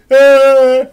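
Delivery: short loud high scream; mock scream. Streamer protesting a Nausicaa remake, hammed-up scream. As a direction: let out a hammed-up shriek of protest.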